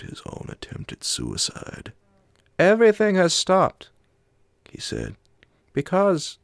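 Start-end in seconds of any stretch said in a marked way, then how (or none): 2.6-3.9 much higher, louder foreign companion voice; the Swede. A narrator's reading. 5.7-6.4 much higher, louder foreign companion voice; the Swede. A narrator's reading.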